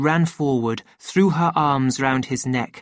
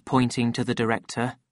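usual speaking voice

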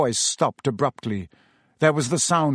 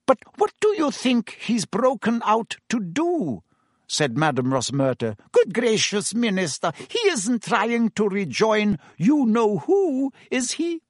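high, anxious woman's voice; alarmed barmaid Rosmerta; 9 semitones higher than usual. Acted. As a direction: gasp out the fear.

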